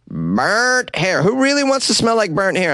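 high disbelieving question; incredulous. Real, not acted. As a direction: sound baffled someone would want that. Incredulous repeat of product name.

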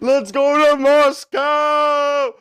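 loud, slow, drawn-out cheer; excited shout. Real, not acted. Hyped friend shouting his plan to move to Moscow. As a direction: yell it out like a rallying cry.

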